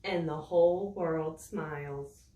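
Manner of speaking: slow, expressive read-aloud for little ones; storybook reading. A narrator's reading. Teacher reading a rain book to young children, expressive storytelling. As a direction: savour each line like bedtime story.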